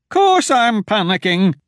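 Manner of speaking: high, loud, slow frightened retort; high frantic admission; panicked. A narrator's reading. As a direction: shout back in fear. Wilmer admitting panic.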